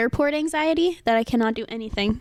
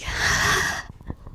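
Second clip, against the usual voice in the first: big breath out. Retold or imitated. Flustered traveler after security, big acted sigh. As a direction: let out a heavy sigh of relief. Acting out calming down.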